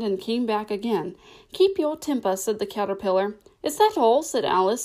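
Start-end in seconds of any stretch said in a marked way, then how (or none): 1.5-2.3 higher, admonishing voice; lightly voiced, high caterpillar. A narrator's reading. 3.6-4.2 higher, louder, mildly indignant question; a touch of restrained annoyance; swallowed anger. A narrator's reading. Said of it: "swallowing down her anger".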